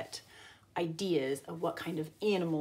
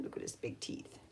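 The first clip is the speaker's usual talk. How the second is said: whisper; 7 semitones lower, 14 dB quieter than usual. Real, not acted. Teacher pointing at the picture, whispering. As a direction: whisper it like a secret.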